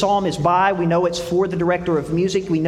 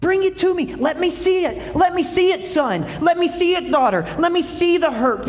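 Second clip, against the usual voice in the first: much higher, pleading and insistent; God answering the angry child, urging and tender; God's tender reply; 9 semitones higher than usual. Acted. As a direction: invite them in, urging and gentle. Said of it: "And he says".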